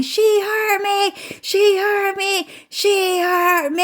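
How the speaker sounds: very high, slow wailing complaint; imitated whiny crying. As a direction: whine it out like a crying child, up high. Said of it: "she kept screaming".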